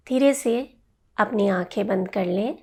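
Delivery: guided meditation. Acted. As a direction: guide them gently into rest, phrase by phrase. Leads a yoga nidra relaxation.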